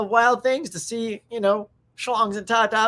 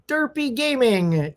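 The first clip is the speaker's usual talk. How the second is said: ordinary delivery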